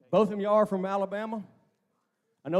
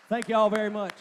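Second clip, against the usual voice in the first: ordinary talk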